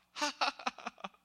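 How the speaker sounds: loud high giggle; high burst of laughter. Acted. Girl seeing a vision in the meeting, laughing loud and high. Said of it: "Then she begins to laugh".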